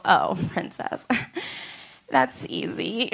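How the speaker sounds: hushed, slow teasing hint; sly whisper. Retold or imitated. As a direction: breathe it like a secret clue. Acting sly hint to boyfriend.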